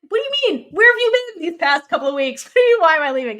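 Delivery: high, loud disbelieving outburst with a laugh; exasperated outburst. Retold or imitated. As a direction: throw it back at her, high and incredulous. [name] confronts Sam in retold fight.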